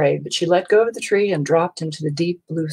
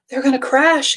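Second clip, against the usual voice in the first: high, breathless alarm; alarmed gasp; 8 semitones higher than usual. A narrator's reading. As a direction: gasp it in fright. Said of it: "gasped Stellaluna".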